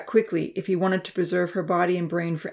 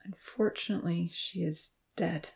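agonized; 9 dB quieter than usual. A narrator's reading. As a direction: force out the words in anguish.

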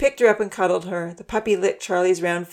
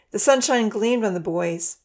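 ordinary delivery

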